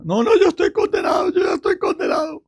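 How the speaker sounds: high, fast, anguished wail of the damned; despairing sinner. Retold or imitated. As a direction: cry out like someone who believes he is lost. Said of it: "desesperación es al contrario".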